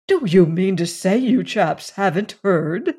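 ordinary delivery

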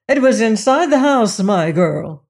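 loud scornful correction. Acted. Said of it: "said her aunt scornfully".

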